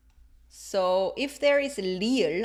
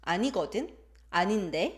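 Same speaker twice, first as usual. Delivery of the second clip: 아니거든 and 아닌데 each lifting at the end; rising intonation; much faster than usual. Shown as a demonstration. Teacher showing both teasing endings, rising intonation. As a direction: raise the last syllable of 아니거든 and 아닌데. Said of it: "rising intonation".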